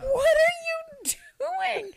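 high, slow, stretched out in horror; shocked disbelief. Retold or imitated. Teacher catching a kid in the sink, high and stunned. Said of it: "And I'm like".

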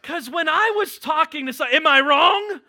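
loud, high, quickening sermon voice; preaching with fervor. Real, not acted. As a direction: whip the crowd up mid-story. Preacher ramping up a story.

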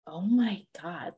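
breathy stunned whisper. Retold or imitated. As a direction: whisper it in disbelief. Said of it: "I was like".

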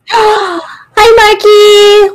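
high, excited squeal of a greeting; cutesy squeal. Pretend. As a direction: squeal out a cute hello.

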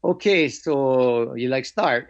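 slow, light imitation of a Hawaiian pidgin accent; mildly voiced Hawaiian pidgin sensei, slowed. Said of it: "he was a brother from Hawaii".

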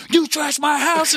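high, loud mock outrage; high, indignant accusing yell; angry dad. Retelling angry dad on phone.

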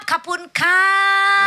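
very slow, loud, high Thai-style greeting; mock Thai shout. Calling the doctor, slow loud high mock Thai greeting. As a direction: belt out a slow fake Thai thank-you.

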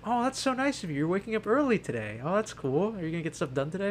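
high, bright mock mom voice; chirpy imitated mother, well above own pitch; imitating mom. Mom's cheerful morning greeting retold.

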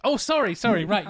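high, tumbling mock apology; imitated flustered apology. Castmates realising it was the take, mock flustered apology. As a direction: start high, apologise as if caught out.

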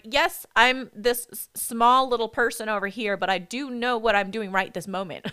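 retelling her irritated reply to the dudes, loud; indignant retort. Retold or imitated. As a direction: snap back at the gym dudes, loud and sharp. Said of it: "I was so pissed".

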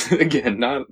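ordinary talk, nothing marked

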